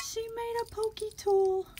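gasp, then high-pitched squeal of delight; delighted surprise. Real, not acted. Crafter spotting a handmade tool, thrilled surprise.

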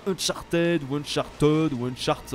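"Uncharted" mangled three ways, ending -ted, -tod, then cut short; wrong pronunciations. Shown as a demonstration. Player mocking ways to say "Uncharted", slowly mispronounced. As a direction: slowly try "Uncharted" with different French-sounding wrong endings. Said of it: "c'est pas Uncharted ou Uncharted".

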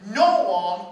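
a full-voiced shout; preacher's ringing shout; sudden shout. Real, not acted. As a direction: belt out the words. Preacher's climactic declaration.

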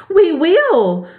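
high, shrill mouse cry; shrill chorus. A narrator's reading. Mice promise their Queen to obey, shrill. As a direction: pipe it up shrilly, like eager mice. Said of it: "cried all the mice in a shrill chorus".

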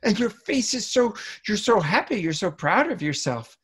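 very high, gushing cheer; happy toddler praise. Acted. Parent cheering a very happy child, excited praise. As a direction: celebrate excitedly in a high voice, gradually settling. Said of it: "We go".

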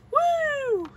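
long, very high "woo"; playful whoop. Pretend. Child cheering during play, long high whoop. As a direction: draw out a high-pitched whoop.

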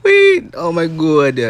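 loud, very high frightened wail; frantic high-pitched cry; panicked shrieking. Real, not acted. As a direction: squeal and plead as things jump at you. Overwhelmed by jumping enemies.

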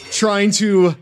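hammy announcer voice; show host intro. Acted. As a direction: open the show like a grand host. Hosts mock show intro.

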